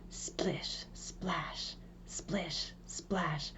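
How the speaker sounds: soft, near-whispered splish splash; creeping sound words. A narrator's reading. Narrator to listeners, creeping hushed sound words. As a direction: hush it like something sneaking up. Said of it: "something came creeping".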